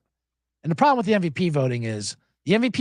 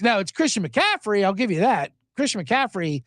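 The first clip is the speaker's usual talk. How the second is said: ordinary delivery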